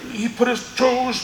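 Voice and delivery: high, slow whimper with a gasp; hammed-up whine, fighting back fake tears; mock near-crying. Fighter complaining to his coach, comically almost crying. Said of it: "I'm not crying, but I'm like".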